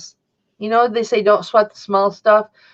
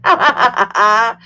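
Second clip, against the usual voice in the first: short loud burst of laughter; real laugh at the joke; 5 dB louder than usual. Real, not acted.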